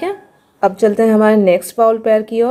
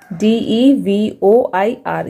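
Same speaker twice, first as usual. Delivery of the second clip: slow spelling; much slower than usual. Shown as a demonstration. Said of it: "spelling letters slowly".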